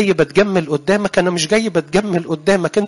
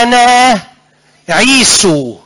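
false claim; 6 semitones higher, 5 dB louder, much slower than usual. Acted. Jacob claims to be Esau.

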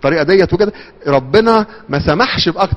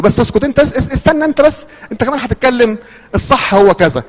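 high, pushy, talking over her; bossy interrupting; 8 semitones higher than usual. Retold or imitated. Husband silencing his wife mid-argument, high and pushy. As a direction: cut her off and insist you are right.

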